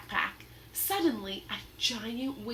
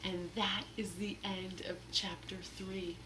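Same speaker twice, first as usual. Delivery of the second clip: soft dramatic whisper; 2 semitones lower, 9 dB quieter than usual. Real, not acted. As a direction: hush the chapter ending. Reader closes chapter dramatically.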